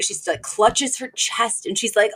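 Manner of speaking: ordinary talk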